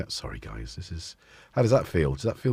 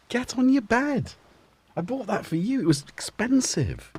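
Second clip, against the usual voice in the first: much higher coaxing pet voice; talking to the cat; 14 semitones higher than usual. Real, not acted. Owner sends the cat to its bed, high and coaxing. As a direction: shoo the cat to bed, sweetly.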